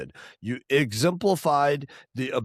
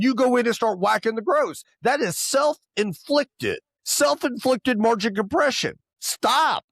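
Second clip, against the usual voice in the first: emphatic raised voice; 6 semitones higher than usual. Real, not acted. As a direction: scold them, drive it home. Heated rant at complacent dealers.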